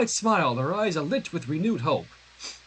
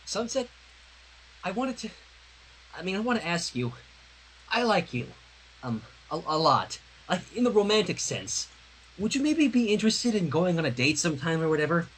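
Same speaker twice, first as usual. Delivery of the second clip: halting shy confession, a hint of Twilight; Twilight shyly asking. Reader voicing Twilight asking Sunset out, nervous.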